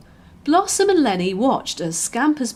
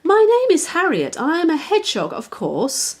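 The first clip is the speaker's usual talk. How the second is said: higher, louder, bright little hedgehog; 8 semitones higher, 3 dB louder than usual. A narrator's reading. Hedgehog introduces herself to the puppy, higher and louder. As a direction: pert and proud, announce yourself.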